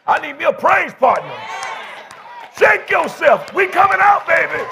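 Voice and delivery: high, shouted church preaching; full-force preaching. Real, not acted. Pastor rallies weary congregation to praise, full-force preaching.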